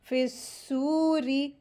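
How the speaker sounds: फिस्सूरी with held s and long oo; joined and stretched. Shown as a demonstration. Teacher models joining letters, held s and long oo in फिस्सूरी. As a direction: join into the heavy s of फिस्सूरी, stretch oo. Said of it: "इसको जल्दी पढ़ेंगे".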